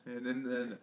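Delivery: ordinary delivery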